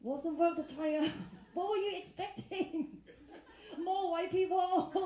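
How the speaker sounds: loud, high-pitched mock outrage through laughter; teasing disbelief. Real, not acted. Comic to audience member who disliked Australia, mock-incredulous teasing.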